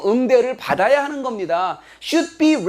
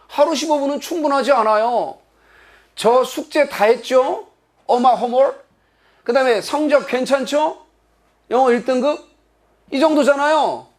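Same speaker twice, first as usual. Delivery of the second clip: put-on higher-pitched child calmly making a case to parents; lightly acted, reasonable kid negotiating; reasoning child; 5 semitones higher than usual. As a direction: argue it logically and politely, pitched up. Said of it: "아이가 자기 부모한테 이성적으로 말하는".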